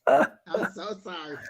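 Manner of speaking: loud, laughing through the words; laughing apology. Real, not acted. Co-host apologising for her son's interruption, laughing. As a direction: apologise while still cracking up.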